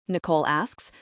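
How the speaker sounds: ordinary delivery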